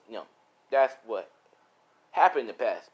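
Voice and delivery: ordinary delivery, nothing marked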